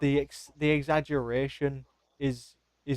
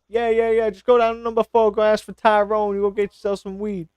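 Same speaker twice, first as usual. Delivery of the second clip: higher and louder, breezy American dealer; brash and loud, quoting the comedian's street guy; comedian's American dealer pointing the way, loud and breezy; 7 semitones higher, 6 dB louder than usual. Retold or imitated. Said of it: "if you go to America".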